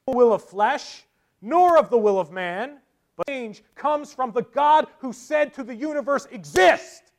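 loud, high, ringing proclamation; full-force preaching. Acted. Preacher quoting to the congregation about God's power, proclaiming loudly. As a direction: build to a shouted final command.

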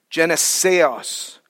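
drawn-out Greek word "Geneseos" for listeners; slow Greek reading. Shown as a demonstration. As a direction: sound out "Geneseos" syllable by syllable. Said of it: "read it again slowly in Greek".

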